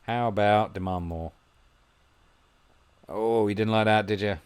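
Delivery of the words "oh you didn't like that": usual speaking voice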